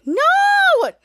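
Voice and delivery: high, loud, drawn-out wail; dismayed cry. Retold or imitated. Piper refused by the phone, high loud dismayed cry. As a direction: cry out in acted frustration.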